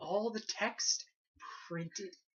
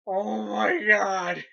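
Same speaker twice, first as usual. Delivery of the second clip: exasperated cry; 8 dB louder, much slower than usual. Real, not acted. Exasperated outburst about reporter.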